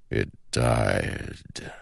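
slow, soft and hollow; slow ancient shadow voice. Acted. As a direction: speak like a patient shadow god. The shadow speaks.

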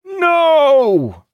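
very high, loud, long wail; anguished scream. A narrator's reading. Captain watching his ship being lost, screaming. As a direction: scream it out at full pitch. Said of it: "Idara screamed".

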